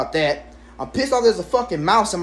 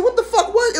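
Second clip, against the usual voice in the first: startled yelp. Retold or imitated. Startled by something running past.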